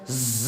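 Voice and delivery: a short voiced buzz on "ز"; buzzing letter demo. Shown as a demonstration. Teacher counting one alif for students, quiet buzz. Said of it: "یہ ایک الف ہو گیا".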